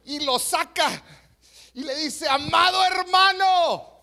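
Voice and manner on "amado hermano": zealous pastor. Acted. Pastor to the drunk after a second dunking, zealous shout. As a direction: cry it out as a hammed-up, over-zealous pastor. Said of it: "el pastor le entra más celo".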